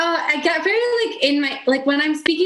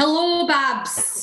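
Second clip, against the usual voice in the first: slow, raised Scottish greeting; Lawrence Chaney's Scottish accent; 4 semitones higher, much slower than usual. Shown as a demonstration. Contestant demonstrating Lawrence for host, slow Scottish accent. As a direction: stretch hello with a high Scots lilt. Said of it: "I feel like Lawrence says".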